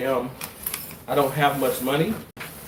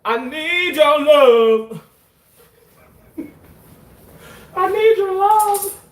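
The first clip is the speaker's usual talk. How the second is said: hammed-up plea; 13 semitones higher, 7 dB louder than usual. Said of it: "performing a begging bit".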